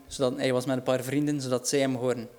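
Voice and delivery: ordinary talk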